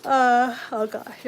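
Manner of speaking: talking through a real yawn; tired yawn breaking into the words. Real, not acted. Streamer caught yawning on four hours of sleep, yawning. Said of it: "I'm yawning as I say that".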